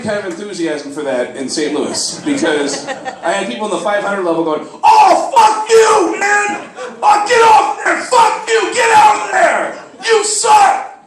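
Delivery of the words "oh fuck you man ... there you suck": high, loud yell from the cheap seats; angry stadium heckler, full voice; heckling shout. Acted. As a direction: scream abuse down at the field like a furious fan. Upper-deck fans heckling him.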